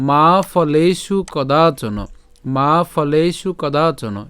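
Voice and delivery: very slow মা ফলেষু কদাচন with long vowels; careful Sanskrit recitation. Shown as a demonstration. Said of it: "শুদ্ধ সংস্কৃত উচ্চারণ". (খুব ধীরে টেনে মা ফলেষু কদাচন)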